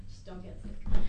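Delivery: chuckling warning to the listeners; half-laughing advice. Real, not acted.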